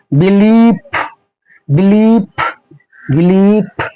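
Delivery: बिलीफ repeated with the ee stretched out; long vowel. Shown as a demonstration. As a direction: drill बिलीफ, holding the second syllable. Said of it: "थोड़ा लंबा समय लगता है".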